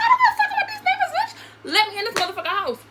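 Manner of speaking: very high, loud, fast outburst; re-enacted angry yelling. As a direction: yell over him to demand entry. Re-enacting yelling at him at door.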